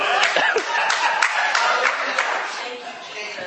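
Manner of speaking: high, breaking laugh through tears; emotional laugh; teary laugh. Real, not acted. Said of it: "I'm getting emotional".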